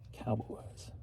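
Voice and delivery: barely voiced, holding back tears; choked-up whisper. Real, not acted. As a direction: breathe the word out through real tears. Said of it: "say that without tearing up".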